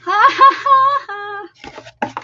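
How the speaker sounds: big burst of laughter; loud real laugh. Real, not acted. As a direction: laugh out loud, high and hard. Loud laugh at the friend's joke.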